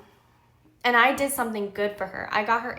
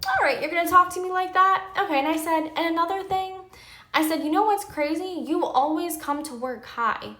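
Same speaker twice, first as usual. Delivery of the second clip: raised, higher-pitched retold telling-off; heated confrontation; 8 semitones higher, 5 dB louder than usual. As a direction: confront them, voice pitched up in indignation. Retold confrontation with her cousin.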